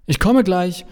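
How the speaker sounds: high, loud call back, fond; a loving holler up the stairs; affectionate yell. A narrator's reading. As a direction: shout back lovingly to upstairs. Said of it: "brülle ich liebevoll zurück".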